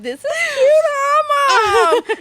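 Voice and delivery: high, laughing and loud, shouting to mom; squealing child delighted with her treat; laughing kid voice. Retold or imitated. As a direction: giggle it out like a happy kid with a cone. Kid with ice cream, delighted.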